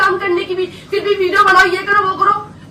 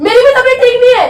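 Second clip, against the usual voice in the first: loud, high, fast comeback; angry retort; 6 semitones higher, 7 dB louder, faster than usual. Real, not acted. Wife throws husband's illness excuse back, angry. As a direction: fire it straight back, louder and higher. Quarrel, snaps back about health.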